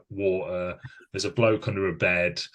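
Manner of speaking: ordinary talk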